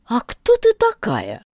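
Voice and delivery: slow, scornful question; contemptuous. A narrator's reading. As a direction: look down on her as you ask. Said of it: "проговорила гусеница с презрением".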